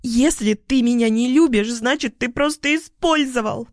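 high, hurt outcry; shouting through tears. A narrator's reading. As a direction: cry it out as if tearful. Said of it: "кричит она сквозь слёзы". (кричит сквозь слёзы, высоким голосом)

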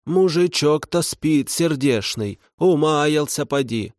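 put-on high, slow old ghost woman; slow rustle of an old woman's ghost; rustling ghost voice. A narrator's reading. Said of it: "прошелестел призрак".

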